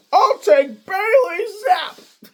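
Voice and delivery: high, slow, crying mock wail; mock whiny cry. Mocking Jason Lloyd's pick with a high crying wail. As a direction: wail it like a crybaby mocking him.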